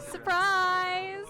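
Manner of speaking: loud sing-song "Surprise!"; excited shout. Real, not acted. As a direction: tease them with a gleeful shout. Gleeful reveal, teasing.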